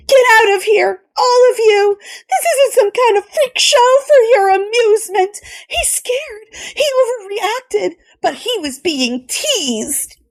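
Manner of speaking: high, tearful angry outburst; furious Kelly. A narrator's reading. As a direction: drive the men out, near tears.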